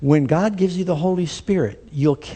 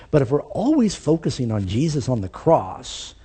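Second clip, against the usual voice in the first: ordinary delivery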